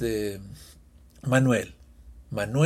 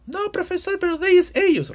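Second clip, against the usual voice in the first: confused student. Pretend. Teacher mimics a student objecting, high mock voice. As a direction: play the puzzled pupil protesting to the teacher.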